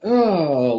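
ordinary talk

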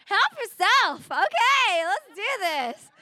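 high, loud whoop of approval; eager consent. Retold or imitated. Answering lion man's proposition, loudly eager. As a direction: throw it out high and gleeful.

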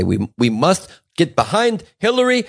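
slow, high-pitched stump-speech voice; Bernie Sanders. Acted. Comic imagining his concession speech for Hillary, politician impression. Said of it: "Bernie will say".

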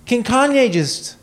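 exasperated plea. Real, not acted. Exasperated at [name].

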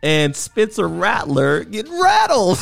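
giggling through the words, slow; gloating, chuckle-filled delivery; laughing through words. Real, not acted. Mocking a rival team's collapse.

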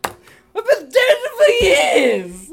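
slow, high, loud wail; wailing ghoul. Acted. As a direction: moan like a zombie nanny. Undead Mary Poppins wailing.

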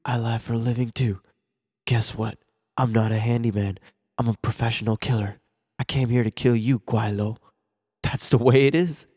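low, steady tough-guy voice; a hint of a cold killer; lightly voiced Seagal. Friend acting out Seagal telling Chen he is a killer, in a low voice. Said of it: "Steven goes".

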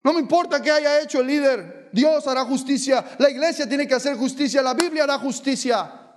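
forceful pulpit preaching, rising to shouts. Acted. Preacher warning young people, forceful preaching. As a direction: drive the warning home from the pulpit. Preacher warns about leaders.

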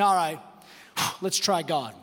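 low, sighing resignation; resigned. Acted. People turning to God as a last resort, resigned. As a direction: give in with a heavy sigh, voice dropping.

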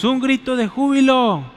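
a half-hearted shout of joy; a feeble shout that gets no echo; weak cry of joy. Shown as a demonstration. Pastor demos a cry of joy, weakly at first. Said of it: "Uy, qué chafa, hermanos, más fuerte".

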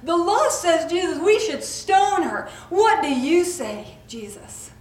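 loud, demanding accusers dragging her to Jesus; self-righteous crowd calling for stoning; accusing mob. Acted. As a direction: push Jesus for a verdict, loud. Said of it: "they say".

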